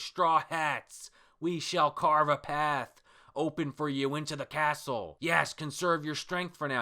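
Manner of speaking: hammed-up noble swordsman; heroic samurai. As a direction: proclaim it grandly to allies. Acting samurai's heroic declaration.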